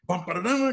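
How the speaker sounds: punchy sung-out drum-and-brass flourish; slam-bang intro imitation. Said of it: "it was a real slam".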